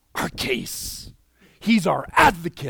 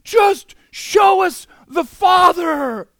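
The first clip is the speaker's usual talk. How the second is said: much higher, loud exclaimed plea; worked up; 11 dB louder, much slower than usual. Retold or imitated. Thomas begging Jesus to show the Father, worked-up higher shout. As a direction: burst out as an eager disciple begging to see the Father. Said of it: "he kinda gets worked up".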